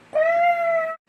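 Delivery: high, loud purring; cat purr. Acted. Cat purring a song to the dog, high. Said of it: "So cat went".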